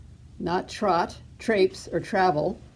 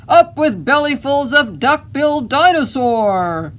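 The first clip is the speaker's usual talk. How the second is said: loud, high rallying roar; shout; 7 semitones higher, 7 dB louder than usual. A narrator's reading. Tyrannosaurus pair hungry for duck-billed dinosaur, shouting. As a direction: shout it out as a hungry battle chant. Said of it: "And they shouted".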